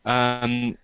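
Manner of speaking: ordinary talk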